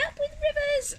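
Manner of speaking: very high duckbill hoot; delighted hooting. A narrator's reading. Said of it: "And they hooted".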